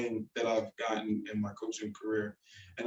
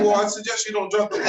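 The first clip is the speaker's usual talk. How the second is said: teasing; 8 semitones higher, 6 dB louder, faster than usual. Retold or imitated. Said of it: "I was like".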